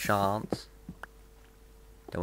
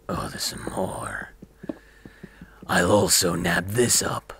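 greedy whisper to himself; gleeful hoarding whisper; sneaky whisper; 3 semitones lower than usual. Pretend. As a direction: hunch over the ore and whisper. Sneaking up on ore, muttering.

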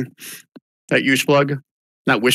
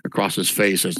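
ordinary delivery

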